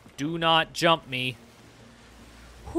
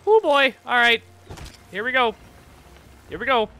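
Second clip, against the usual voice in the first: excited; 9 semitones higher, 7 dB louder than usual. Real, not acted. Battle starting.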